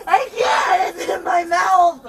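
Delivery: sobbing, desperate begging; crying and pleading. Real, not acted. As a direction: beg through real tears. Pleading, crying under spit mask.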